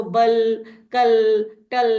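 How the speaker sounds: बल, कल, टल as separate single syllables; consonant plus LE sounds. Shown as a demonstration. Teacher demonstrating consonant plus LE, each syllable isolated. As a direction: punch out बल, कल, टल, each ending in L. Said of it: "to complete the sound of".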